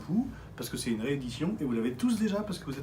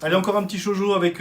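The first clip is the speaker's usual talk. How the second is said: ordinary talk, nothing marked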